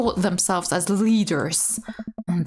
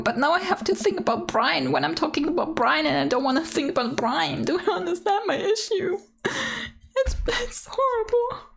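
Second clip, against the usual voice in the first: comic complaint; 8 semitones higher, faster than usual. Real, not acted. Comic complaint about the name.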